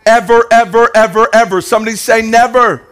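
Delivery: loud, high, driving sermon build; fiery preacher at full force; preaching climax. Acted. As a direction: hammer "ever" home to the crowd.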